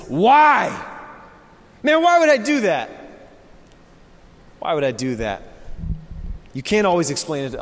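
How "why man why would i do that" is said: high, loud disbelieving why; incredulous why. Retold or imitated. Pastor voicing a doubting listener, high incredulous why. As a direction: voice the listener's baffled objection, pitched up. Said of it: "the natural question as you hear that is".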